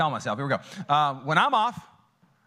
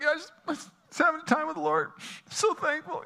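retold crying; 13 semitones higher than usual. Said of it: "bawling my head off".

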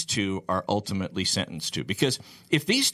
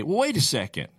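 indignant protest; 7 semitones higher than usual. Retold or imitated. Defendant protesting voiced long.

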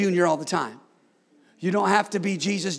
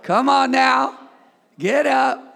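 louder, higher call to get up; call and response; 7 semitones higher, 6 dB louder, slower than usual. Shown as a demonstration. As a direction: rouse your neighbor. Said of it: "Look at your neighbor and say".

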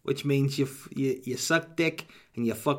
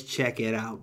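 usual speaking voice

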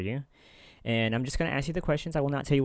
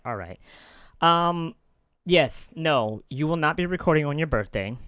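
ordinary talk

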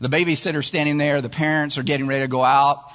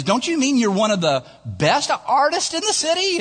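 high-pitched incredulous question; worried disbelief; 10 semitones higher than usual. Acted. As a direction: query it in a high, doubting voice. Worried client queries artist in ad.

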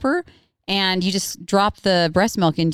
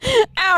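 sudden yelp of pain; startled sharp ow. Real, not acted. Just hit her tooth.

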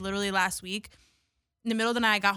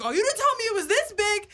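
imitating a friend shocked at the bug's size; much higher startled exclamation; surprised; 10 semitones higher than usual. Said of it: "And then he's like".

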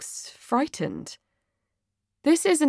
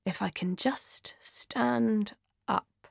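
whispering; 2 semitones higher, 9 dB quieter, slower than usual. Acted. Drunk mumble under table.

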